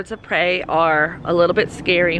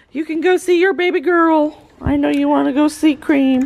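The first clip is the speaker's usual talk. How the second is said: high, louder sing-song coaxing; high chirpy pet voice; 9 semitones higher, 5 dB louder than usual. Real, not acted. Sweet-talking the goat mother.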